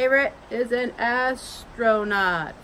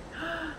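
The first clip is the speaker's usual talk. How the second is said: surprised gasp; 7 semitones higher, 11 dB quieter than usual. Pretend. Surprise at drawing.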